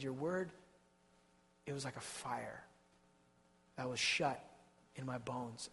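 soft, low, worn-out admission; weary confession. Acted. Jeremiah confessing to God, soft and worn out. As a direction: barely get the words out, spent. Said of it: "He said".

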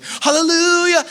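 high, loud, drawn-out joyful shout; hammed-up hallelujah from the cell; mock triumphant Paul. Paul in prison mockingly shouting praise, loud and high. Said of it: "Paul's like".

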